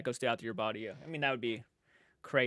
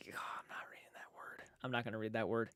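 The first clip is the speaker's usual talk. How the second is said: softer, unvoiced whisper of refusal; 4 semitones lower, 13 dB quieter than usual. Real, not acted. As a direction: whisper it as if the word is dangerous. Refusing to read chat word.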